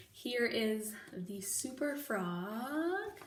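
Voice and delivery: usual speaking voice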